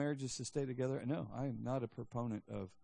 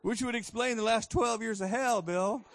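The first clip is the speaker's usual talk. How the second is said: louder, higher mock complaint; joking loud jab; 9 semitones higher, 8 dB louder than usual. Pastor jokes loudly that twelve years were hell. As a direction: punch the punchline at Bill. Punchline shouted at Bill.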